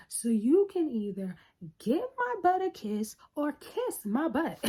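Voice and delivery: high, mocking, punchline voice; mock sassy comeback. Tony mocking her friend with a sassy comeback. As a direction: toss off the comeback with sass. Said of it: "Tony goes".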